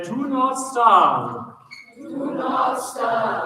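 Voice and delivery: slow, loud, pompous; mock presenter. Shown as a demonstration. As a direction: ham up a boomy presentation. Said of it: "The funnier you make it".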